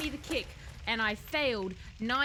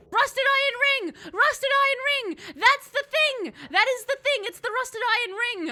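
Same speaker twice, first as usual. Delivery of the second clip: much higher, loud excited yell; excited shout; 11 semitones higher, 9 dB louder than usual. Real, not acted. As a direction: shout it out like you just found the treasure. Recognizes the item she wanted.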